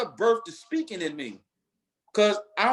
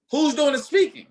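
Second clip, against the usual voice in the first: louder and higher, calling out the room; loud challenge; 4 semitones higher, 5 dB louder than usual. Acted. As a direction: throw the question at them hard. Repeated rhetorical question.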